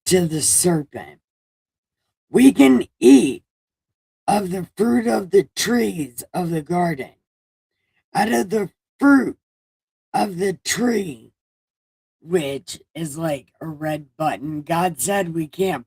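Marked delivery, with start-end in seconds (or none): 2.3-11.3 higher, louder mock Eve voice, a little hammed-up; high put-on Eve voice. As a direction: play Eve as a squeaky, overeager woman. Eve's voice in comic retelling.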